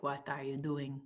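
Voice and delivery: soft, badly faked Russian accent. Shown as a demonstration. Streamer showing her bad accents, clumsy Russian accent. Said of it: "I'm really bad at accents".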